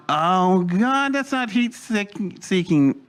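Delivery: high-pitched, drawn-out exclamation; astonished realization. Retold or imitated. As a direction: re-enact a stunned discovery. Retold sudden realization about the hull.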